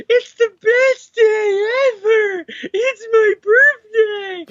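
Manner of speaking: very high, drawn-out cry of delight; overjoyed high-pitched mock voice; gleeful squeal. Imagining a villain thrilled to see the dead walk up, gleeful squeal.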